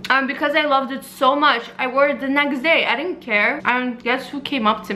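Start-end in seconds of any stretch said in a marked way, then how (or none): none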